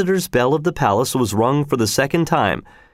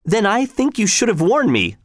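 much higher, irritated snap; snapping; 11 semitones higher than usual. A narrator's reading. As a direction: bite it off, stung and cross. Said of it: "snapped Arnold of Sua".

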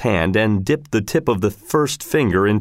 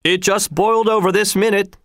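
high-pitched called reply; voice through door; 11 semitones higher than usual. A narrator's reading. Helper replying to the executioner through the door, high call. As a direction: answer from the next room, pitched up. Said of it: "voice from the other side of the door".

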